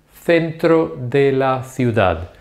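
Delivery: centro de la ciudad with th sounds, very slow; slow Spanish. Shown as a demonstration. Teacher modelling centro de la ciudad slowly. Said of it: "pronouncing them very slowly".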